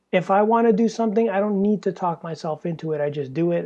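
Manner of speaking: ordinary talk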